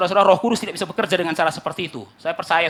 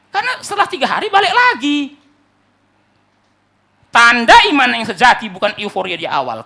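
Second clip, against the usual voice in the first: loud, raised preacher's proclamation; full-force preaching; 4 semitones higher, 12 dB louder than usual. Acted. Preacher telling congregation what true faith is, full-force preaching. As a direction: drive the point home to a crowd.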